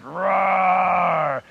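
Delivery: loud roaring lion. Acted. Lion roaring at the creature who woke him. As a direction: roar like a lion woken from sleep.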